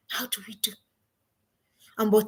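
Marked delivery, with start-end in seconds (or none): 0.1-0.7 whispered self-question, almost voiceless; a hushed, unvoiced how do we do.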